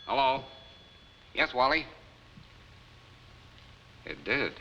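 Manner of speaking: ordinary talk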